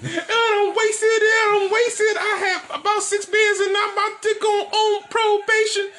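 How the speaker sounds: high sing-song wail; hammed-up drunk lament; mock drunk whine. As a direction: whine like a sloppy drunk hero. Mocks himself being wasted.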